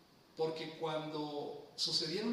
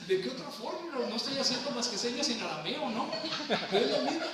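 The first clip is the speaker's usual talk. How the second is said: higher and louder, a playful mock protest; exclamación juguetona; 7 semitones higher, 6 dB louder than usual. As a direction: protest in jest, loud and bright. Bromea que hace señas en arameo. (protesta en broma, más aguda y fuerte)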